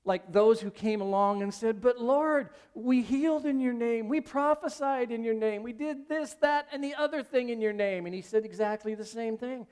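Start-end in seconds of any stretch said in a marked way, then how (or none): 1.8-8.1 higher-pitched mock protest; imitating indignant petitioners; protesting appeal. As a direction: plead your case, item by item. Rejected followers protest to the Lord.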